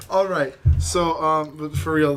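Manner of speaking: usual speaking voice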